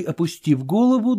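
ordinary delivery

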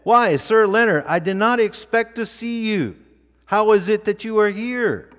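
surprised king. Acted. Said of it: "the king, meeting him ... said".

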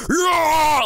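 high, loud mock scream; screaming jock bro. Jock bro staring at a book, high screaming yell. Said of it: "just, like, screaming at it".

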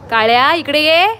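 loud call, rising at the end. Real, not acted. Owner summoning her dog to come, loud call.

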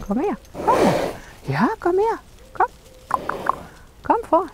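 high, soft sing-song calls; coaxing a horse. Real, not acted. Trainer luring her horse forward, high and soft.

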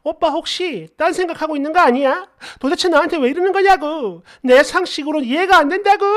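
imitated upset girlfriend, high-pitched; hammed-up high, aggrieved complaint; tearful outburst. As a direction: plead and protest like a wailing girlfriend. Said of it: "울고불고".

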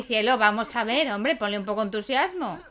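usual speaking voice

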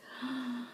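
short gasp of delight; soft surprised gasp; amazed gasp. Real, not acted. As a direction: catch your breath in wonder. Amazed by the gift.

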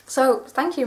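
ordinary delivery

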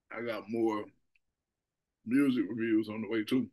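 speaking between bites; talking while chewing. Real, not acted. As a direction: mouth partly full of cookie. Seen 0: Bites and chews a cookie between phrases, hand often at mouth.

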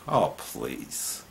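scoffing. Real, not acted. Scoffing at the Democrats.

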